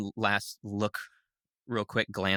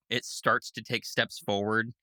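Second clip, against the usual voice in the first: normal talking voice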